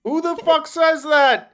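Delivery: slow, high, very loud outburst of disbelief; incredulous shout. Real, not acted. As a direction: yell it in sheer disbelief, stretching each word.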